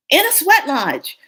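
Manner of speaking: high, loud amused outburst; amused exclamation. Real, not acted. Telling the sweat lodge story, amused outburst. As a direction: exclaim it with a laugh in it.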